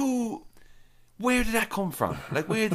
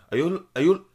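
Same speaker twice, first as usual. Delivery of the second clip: stammered, louder Scottish try veering Irish; false-start Scottish, drifting into Irish; Irish-sounding Scottish attempt; 3 semitones higher, 8 dB louder than usual. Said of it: "you just sounded more Irish then".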